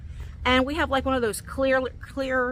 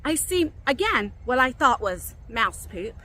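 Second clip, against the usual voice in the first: usual speaking voice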